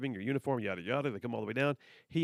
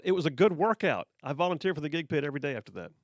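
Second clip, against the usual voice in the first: ordinary talk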